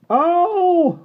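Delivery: high, loud yelp of pain; sharp cry. A narrator's reading. Patrick yelping as the speck is squeezed out.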